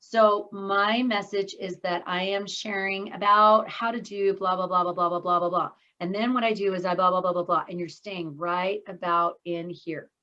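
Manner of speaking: low, droning monotone on one level. Shown as a demonstration. Speaker showing what happens when people share a message, droning monotone. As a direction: drone through it with no lift at all. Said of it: "watch for the monotone".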